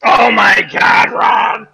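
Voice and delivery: loud, drawn-out shocked cry; yelling at Ron's outrageous story; shocked outburst. Real, not acted. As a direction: burst out in disbelief.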